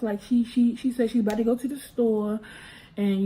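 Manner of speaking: usual speaking voice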